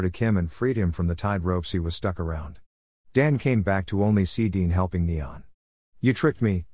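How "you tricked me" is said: usual speaking voice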